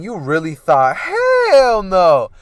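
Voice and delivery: building to a high, drawn-out yell; joking shout that soars on the last words; gleeful fake-out. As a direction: burst out loud to mock the listener. Breaks his own story as joke.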